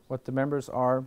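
ordinary talk

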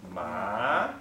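"Ma" drawn out in a rising tone; second tone. Shown as a demonstration. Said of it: "moves upwards like this".

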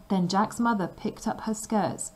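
ordinary delivery, nothing marked

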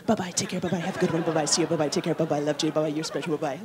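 sing-song autopilot goodbyes, a hint of flight attendant. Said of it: "Three hundred and seventy-five people".

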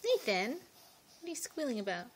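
ordinary talk, nothing marked

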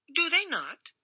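high, sharp challenge; thin stranger's voice. A narrator's reading. Stranger challenges the householders, high and sharp. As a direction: needle him with a pointed question. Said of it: "asked a thin voice".

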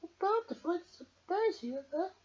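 put-on high little girl voice. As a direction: reassure the teddy brightly. Emily voiced in read-aloud story.

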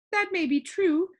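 high, firm concession; stubborn high-pitched character voice. A narrator's reading. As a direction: grant it grudgingly in a high voice. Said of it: "she replied stubbornly".

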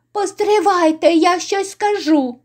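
high-pitched begging to be heard; urgent plea. Acted. Innkeeper halting the beating to confess, high urgent plea. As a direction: call out high to stop them. Beaten innkeeper begs to speak.

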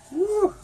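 high burning whoop; pained whoop from spicy heat. Real, not acted. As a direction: shout out the heat.

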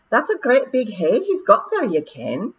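casually admiring the monster; Rob's pixie voice. Acted. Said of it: "said Rob Anybody".

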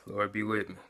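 a soft, hushed plea; softly voiced prayer to the Lord; quiet prayer. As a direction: pray it under your breath. A quick prayer after a deep breath.

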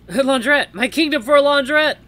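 loud, high theatrical declamation; mock-heroic plea. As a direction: cry out like a desperate stage king.

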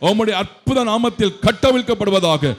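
high, loud commanding prayer; fervent deliverance prayer. Real, not acted. Preacher commanding darkness in a loud prayer. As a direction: cast out evil with full force.

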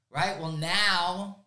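normal talking voice

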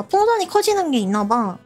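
ordinary delivery

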